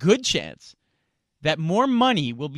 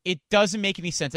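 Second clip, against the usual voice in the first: ordinary delivery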